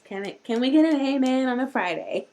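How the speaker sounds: usual speaking voice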